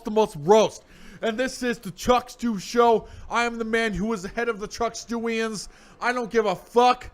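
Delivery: high, hyped announcer voice; hammed-up talk show host; show host intro. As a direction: welcome the crowd like a wrestling emcee.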